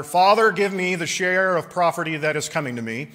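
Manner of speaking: the son's brash demand; demanding younger son. Acted. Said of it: "the younger of them said to his father".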